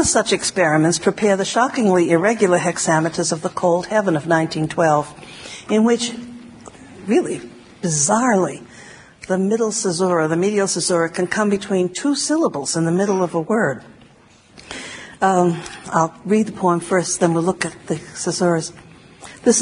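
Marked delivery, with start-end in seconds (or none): none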